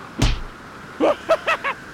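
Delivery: high, unvoiced wheezing cackle; breathy maniacal cackle. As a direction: cackle like a villain letting go. Villain laugh before falling.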